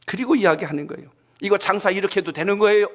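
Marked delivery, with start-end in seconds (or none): 1.4-2.9 high, loud retold rebuke; scolding customer. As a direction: imitate a diner telling off the staff. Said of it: "아주 호되게 뭐라 그러니까". (호되게 따지는 손님 흉내)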